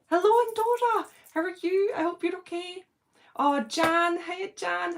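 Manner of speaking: high, loud hello; excited hello to a viewer in Andorra; excited greeting. Real, not acted. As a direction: welcome a viewer warmly and eagerly. Greeting viewer joining live.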